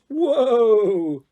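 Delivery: loud high playful whoa; Barry halts his "horse" with a call; horse-rider whoa. Acted. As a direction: rein in a horse with a hearty call. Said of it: "pulled on some imaginary reins and went".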